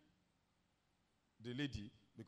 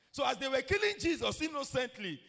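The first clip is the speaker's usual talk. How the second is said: high, loud preacher's cry; impassioned preaching; 10 semitones higher, 8 dB louder than usual. Real, not acted. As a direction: raise the voice in full-force preaching. Preacher building to cry from the cross.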